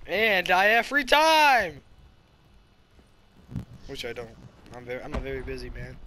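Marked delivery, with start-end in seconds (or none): 0.1-1.8 loud, high, slow proclamation; hammed-up yell. Gamer echoes friend's misheard line, hammed-up drawn-out yell. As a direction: belt it out, stretching the last word.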